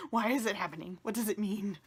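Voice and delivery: loud, overwhelmed questioning; emotional, exasperated bewilderment; bewildered plea. Real, not acted. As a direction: cry out for an answer. Tearful, bewildered plea to viewers.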